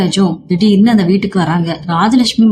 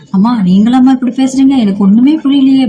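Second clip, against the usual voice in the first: higher and louder, stunned disbelief; shocked outcry; 5 semitones higher, 4 dB louder than usual. Acted. Son shocked hearing his mother apologize, higher and louder outcry. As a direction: cry out in shock at what you hear.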